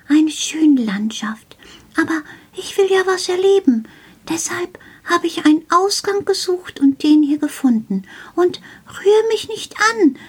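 lightly voiced little gnome, urgent and insistent; a small gnome pleading don't touch me; Karl the shelf gnome. Gnome warns the child not to touch him, lightly voiced and urgent.

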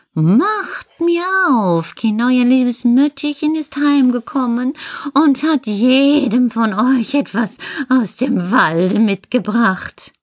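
wolf pretending to be the mother goat, sweetly coaxing; wolf faking mother. Wolf at the door fooling the kids, fake high mother's voice.

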